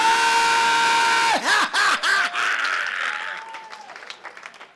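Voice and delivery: loud high cackling shout-laugh; shrieking laugh. Real, not acted. Comic roars laughing at the crowd. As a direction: let out a screaming laugh.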